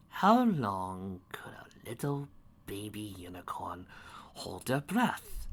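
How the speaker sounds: slow whispered menace; creepy whisper. Acted. Storyteller whispering the sinister question slowly, creepy whisper. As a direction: hush it like a dark secret.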